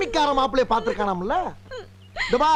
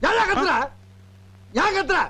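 shouting back; 7 semitones higher than usual. Acted. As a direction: fire the question back hard.

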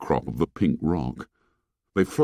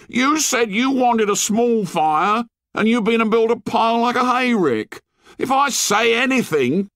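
put-on high, bitter complaining boy; bitter whine; 3 dB louder than usual. A narrator's reading. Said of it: "cried Piggy with bitter realism".

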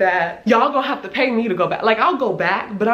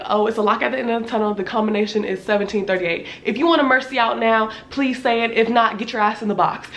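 imitating the worker's rushed, fast rattle. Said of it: "that's how fast she was talking".

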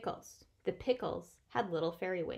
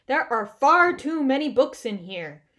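loud, higher complaining Bear; indignant protest; 4 semitones higher, 11 dB louder than usual. A narrator's reading. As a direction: protest it with emphasis. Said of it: "he protested".

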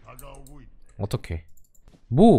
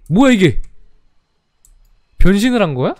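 startled; 7 semitones higher, 9 dB louder than usual. Real, not acted. Opponent transforms.

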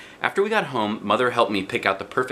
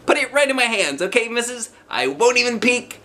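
high, loud pleading eagerness; giddy child waiting for a prize; eager child; 4 dB louder than usual. A narrator's reading. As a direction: beg excitedly with eyes shut.

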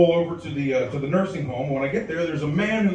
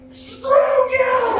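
put-on very high, loud shriek of an old lady; old woman yelling back, mock shrieking; old woman screaming; 6 dB louder than usual. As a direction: explode at him like a furious granny.

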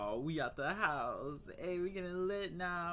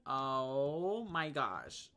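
slow drawn-out reaction; 3 semitones higher, much slower than usual. Real, not acted.

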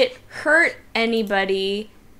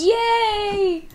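1 happy cheer; 11 semitones higher than usual. Real, not acted. Attack hits, player cheers.

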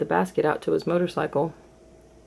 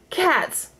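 shocked cry; 12 semitones higher than usual. A narrator's reading. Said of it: "he cried".

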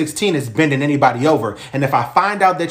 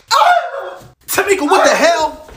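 much higher, louder scream; shocked scream; 14 semitones higher, 5 dB louder, slower than usual. Acted. Wife attacked by the ex, screaming. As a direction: shriek as you're hit.

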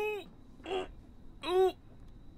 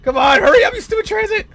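high, loud, frantic yelling; panicked shout; 6 dB louder, faster than usual. Real, not acted. Player begging the transit to hurry, panicked shouting.

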